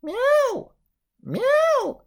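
high, drawn-out cat cries; cat meows. Acted. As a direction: meow like a cat, high and long. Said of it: "crying all together".

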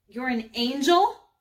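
astonished. Acted. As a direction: ask in disbelief, rising sharply at the end. Girl astonished at talking creature.

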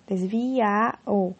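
"desvia o" very slow, the final a and o run together; elision demo. Shown as a demonstration. Teacher slowly blending "desvia o" for the class. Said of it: "o a vai juntar com esse o".

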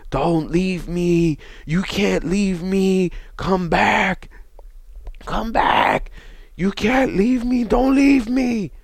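mother's screamed plea to her son on the ledge, loud and high; retold desperate scream. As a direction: cry out begging him not to leave. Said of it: "she was screaming at the top of her lungs".

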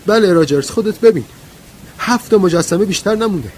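very high, loud outburst; shrill loud woman's cry, sustained high. A narrator's reading. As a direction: cry it out at full pitch, near hysterical. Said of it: "ورا با صدای بلند گفت".

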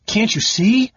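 high, loud frustrated cry. Acted. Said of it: "Caramon cried".

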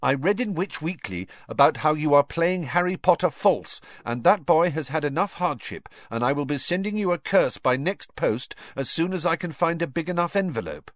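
threatening letter. A narrator's reading. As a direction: read as a huffy letter writer. Hate letter read aloud.

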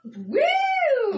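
put-on high, loud party-girl "woo"; drunk woo. Shown as a demonstration. Woman showing the call she does, drunk woo. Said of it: "I do the drunk".

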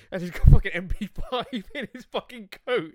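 high, loud, laughing retelling; words bursting out through real laughter. Real, not acted. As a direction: crack up while telling the story. Cracking up retelling film scene.

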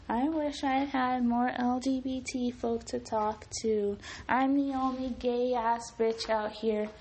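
high, slow, drawn-out complaint; hammed-up plaintive complaint, high and dragging; mock lament. Quoting own repeated podcast complaint.

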